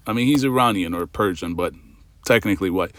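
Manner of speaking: normal talking voice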